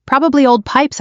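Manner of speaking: plain reading voice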